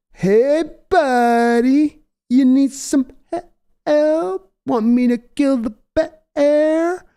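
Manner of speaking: high, slow, drawling mock Pauly Shore; hammed-up Pauly Shore offering help; Pauly Shore impression. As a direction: call down lazily like a surfer dude. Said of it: "you only hear Paulie's voice".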